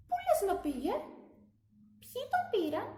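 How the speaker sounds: high, eager questions; curious glasses. A narrator's reading. Other glasses asking the water glass where its friend went, high and curious. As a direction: lean in, curious and concerned.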